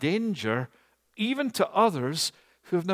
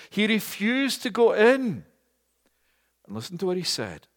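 ordinary talk, nothing marked

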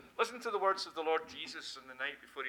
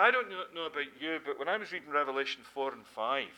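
ordinary talk

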